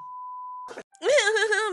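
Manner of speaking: high loud laugh; incredulous cackle; burst of laughter. Real, not acted. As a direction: laugh out in disbelief. Laughs at the fight.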